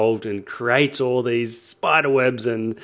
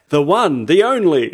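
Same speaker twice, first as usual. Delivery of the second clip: radio announcer voice; 6 semitones higher, 5 dB louder than usual. Retold or imitated. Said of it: "put that radio voice into it".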